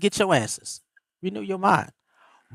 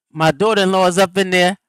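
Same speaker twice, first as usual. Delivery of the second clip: normal talking voice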